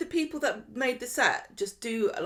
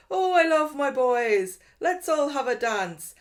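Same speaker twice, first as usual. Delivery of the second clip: cringey mum impression; 6 semitones higher, 6 dB louder, slower than usual. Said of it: "this really cringe scene where they're like".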